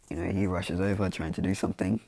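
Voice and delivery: usual speaking voice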